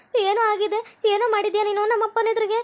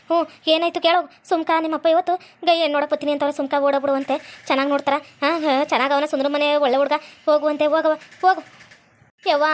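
lower-pitched village mother, hushed and urging; mother's voice; 4 semitones lower than usual. Acted. Mother urges daughter to phone Sundra, low coaxing mother voice.

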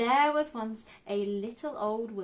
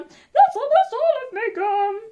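panicked cry; 12 semitones higher, 11 dB louder than usual. A narrator's reading. Being eaten by fox.

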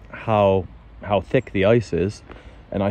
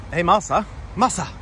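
much higher, sweet dog greeting; cooing at the dog; 8 semitones higher than usual. Real, not acted.

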